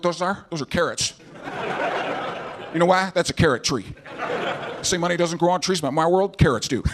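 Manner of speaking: folksy ex-president as TV painter; George W. Bush. Acted. Former president hosting a painting show, folksy and clueless. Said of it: "from George W. Bush. I wanna see a painting show".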